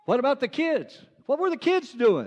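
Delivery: high-pitched, animated questioning; pressing question. Acted. Officer asking monkey about the kids, pressing and high.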